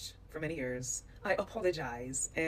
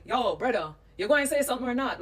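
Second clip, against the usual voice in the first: higher, louder at the end, in Jamaican patois; quick retold Jamaican patois challenge; 5 semitones higher, 4 dB louder than usual. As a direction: call someone out in Jamaican patois. Retells Jamaican man's challenge.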